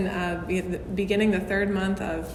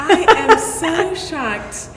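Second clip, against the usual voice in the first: higher, louder and drawn out slowly, mock amazement; playful shock; 8 semitones higher, 8 dB louder, much slower than usual. Real, not acted. Host hearing the guest was born in Scotland, playful drawn-out shock. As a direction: stretch it out as if truly floored. Host reacts to Scottish birthplace.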